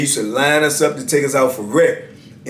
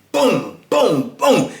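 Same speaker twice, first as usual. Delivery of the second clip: higher-pitched spoken thuds; imitated blows; 6 semitones higher, much slower than usual. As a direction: voice each punch landing, one by one.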